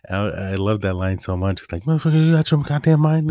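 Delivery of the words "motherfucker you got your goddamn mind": loud, high-pitched exasperated quote; annoyed. Retold or imitated. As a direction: yell it like an irritated action hero. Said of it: "He's, like, annoyed".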